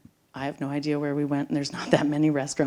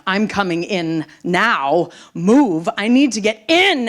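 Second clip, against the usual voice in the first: much louder, demanding; angry shove; 4 semitones higher, 9 dB louder, slower than usual. Retold or imitated. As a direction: force your way through the door. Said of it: "of course, pisses me off".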